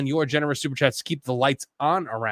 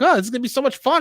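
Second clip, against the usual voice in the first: high, eager mimicry of past excitement; eager anticipation; 8 semitones higher, much faster than usual. Pool owner quoting their hopes before buying it, mock eager. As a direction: quote your naive past self, pitched up. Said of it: "I was like".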